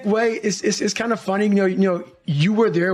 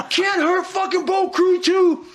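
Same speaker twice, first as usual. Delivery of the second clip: hammed-up team yell, much higher; retold yelling; 12 semitones higher, slower than usual. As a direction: belt it out like a boat crew war cry. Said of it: "We start yelling".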